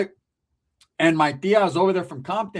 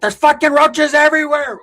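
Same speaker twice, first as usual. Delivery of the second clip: loud, higher complaining aunt; yelling tia; 8 semitones higher, 10 dB louder than usual. Retold or imitated. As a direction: shout it like an outraged aunt. Tia's typical call.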